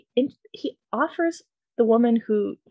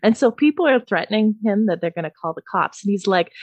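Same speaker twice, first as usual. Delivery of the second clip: ordinary talk, nothing marked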